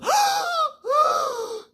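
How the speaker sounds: loud, high, wheezing gasps; exaggerated shocked gasping for air; mock asthma-attack gasp. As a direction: suck in air noisily as if having an attack. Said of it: "had an asthma attack".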